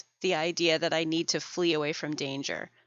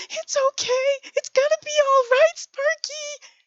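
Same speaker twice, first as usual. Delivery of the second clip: put-on high, loud, anxious baby-talk to a dog; mock a panicked owner soothing a scared dog; panicky owner; 7 dB louder than usual. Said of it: "starts talking like this".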